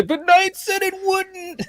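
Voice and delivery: high, slow mock-accusing voice; mocking whine. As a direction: whine it back like a disappointed fan. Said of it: "I don't want people to say".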